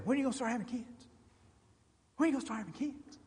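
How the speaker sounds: high, fast, excitable nagging voice; mimicking a spazzy church member; hyper church member. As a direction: rattle it off like an overeager busybody. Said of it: "now, he was a spaz".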